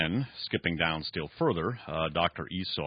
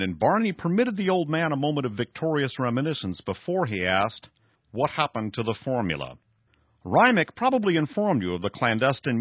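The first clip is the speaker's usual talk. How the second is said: raised, emphatic story reading; dramatic novel reading; 5 semitones higher, 5 dB louder than usual. Acted. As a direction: read the villain's boast with drama. Reads novel passage aloud.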